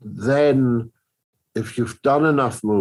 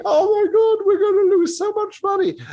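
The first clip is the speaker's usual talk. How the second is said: much higher and louder, hammed-up panic; mock panicked executives; 4 dB louder than usual. Scared executives fearing the loss of money, mock panic. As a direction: squeal like a frightened studio suit. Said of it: "scared executives sitting there, you know, going".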